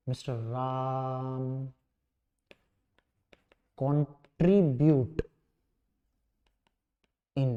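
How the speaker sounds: low, measured dictation with long gaps; softly spaced-out classroom dictation; slow dictation. Real, not acted. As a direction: dictate word by word so students can write. Dictates answer for students to write.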